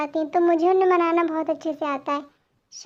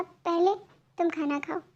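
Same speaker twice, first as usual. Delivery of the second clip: usual speaking voice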